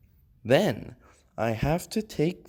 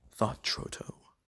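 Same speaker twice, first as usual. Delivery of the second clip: usual speaking voice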